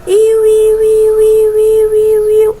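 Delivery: long high loud siren wail; imitated continuous siren. Shown as a demonstration. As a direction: wail it like a siren without a break. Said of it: "ela vai sequência".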